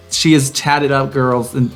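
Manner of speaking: normal talking voice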